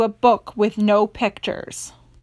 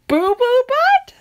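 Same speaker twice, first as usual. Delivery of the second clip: disbelieving; 12 semitones higher than usual. Real, not acted. Reader disbelieving silly name.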